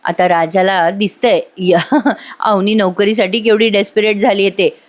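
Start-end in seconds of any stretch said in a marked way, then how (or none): 1.8-2.4 small laugh slipping out mid-sentence; amused laugh. Real, not acted. Amused at the character's desperation.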